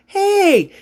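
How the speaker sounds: high, bright "Hey!" called across the mall; excited greeting call. Retold or imitated. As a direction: call out to a friend you just spotted. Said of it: "Nick was like".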